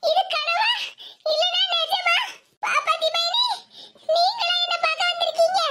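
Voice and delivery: high, halting voice of wonder; acted amazement, pitched high; dazed disbelief. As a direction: ask as if waking into a dream. Waking patient doubts her visitor is real.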